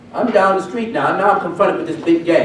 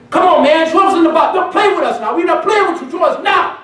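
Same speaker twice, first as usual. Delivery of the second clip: high, loud mock taunt; hammed-up street tough pushing; impatient gang leader; 12 semitones higher, 4 dB louder than usual. Gang leader taunting the preacher on the street.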